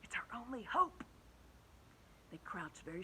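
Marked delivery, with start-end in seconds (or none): 0.0-1.0 hushed whisper. A narrator's reading. James hushing his friends on the peach, whisper. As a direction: keep it under your breath. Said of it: "whispered James".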